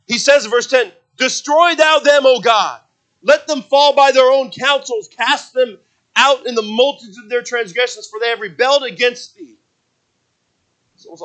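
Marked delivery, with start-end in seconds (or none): 1.2-9.6 loud, raised preaching voice; ringing plea to God, pitched high; forceful scripture reading. Acted. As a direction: declaim the psalm with full force. Declaims the psalm as scripture.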